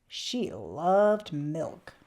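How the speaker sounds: slow, hushed and fond; tender. A narrator's reading. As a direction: savour it like a bedtime secret. Cozy story moment about the cat.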